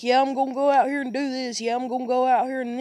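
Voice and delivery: imitating dad. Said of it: "He'd be like".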